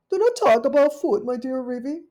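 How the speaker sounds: high, loud genteel caller; a polite little dog at the door; Duchess's voice. Acted. Duchess calling at Rivy's porch, high and genteel.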